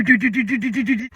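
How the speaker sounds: soft rapid knock noises; knocking sounds. Retold or imitated. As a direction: tap out a quick knocking rhythm. Imitating knocking on door.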